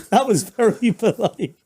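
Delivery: laughing through the words; amused, giggling delivery. Real, not acted. Laughing at friend's polite phrasing.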